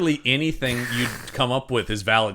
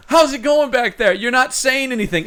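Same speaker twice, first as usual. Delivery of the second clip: mock parent calling back, higher; raised voice to the back seat; 12 semitones higher, 5 dB louder than usual. Parent yelling to kids in back.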